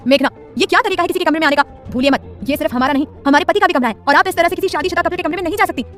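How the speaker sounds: wife scolding her friend, high and loud; a bit angrily. Acted. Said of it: "थोड़ा गुस्से से बोली".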